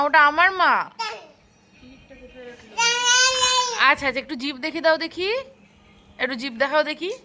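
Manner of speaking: put-on high, loud sing-song coo to a toddler; baby talk. Parent cooing at her small child, high baby talk. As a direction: coax the little one with bright baby talk.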